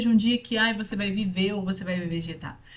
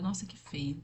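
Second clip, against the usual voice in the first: disgusted aside; 5 dB quieter than usual. Retold or imitated.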